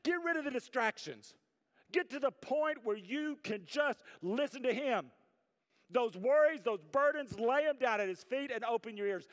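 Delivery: high, loud pulpit exhortation; impassioned, insistent preacher; full-force preaching. Real, not acted. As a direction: drive the charge home to the congregation.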